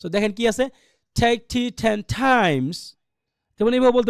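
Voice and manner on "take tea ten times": "take tea ten times" with TIMES long and strong; stress on TIMES. Shown as a demonstration. Teacher shows where imperative stress falls, "times" drawn out. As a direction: stretch out "times" at the end, other words short. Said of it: "আবার লম্বা করে পড়বো".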